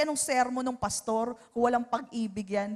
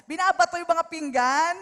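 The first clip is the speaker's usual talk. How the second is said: usual speaking voice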